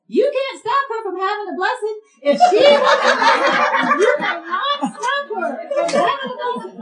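high, loud, insistent outburst; emphatic scolding. Retold or imitated. Ticket lady telling off a hesitant Marine, loud emphatic insistence.

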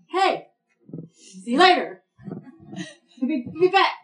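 short, loud hurried greetings; passing call-out. Acted. Busy friend dashing past without stopping, mock hurried call-out. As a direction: toss it out in loud bursts while rushing off. Acts out hurried, shallow relationship.

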